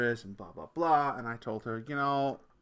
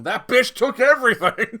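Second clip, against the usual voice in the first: mock bitter ex-husband; 10 semitones higher, 14 dB louder than usual. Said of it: "I could be on here going".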